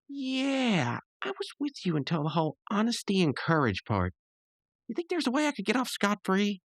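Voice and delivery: high-pitched character voice; imitated cartoon friend. Rigby looking for a way out, high mock wheedle. Acting Rigby's reply to Skips' advice.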